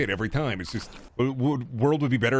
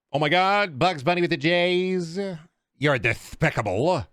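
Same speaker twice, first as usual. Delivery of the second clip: cartoon voice; 4 semitones higher, slower than usual. Cartoon voice joke on Twitter.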